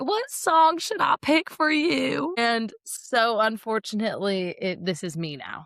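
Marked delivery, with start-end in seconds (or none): 0.0-2.3 high, slow, hammed-up Southern drawl; sugary mock-Southern offer, drawn right out; Southern accent. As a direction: play an exaggerated Southern belle, high and slow. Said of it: "we're all talking in a Southern accent".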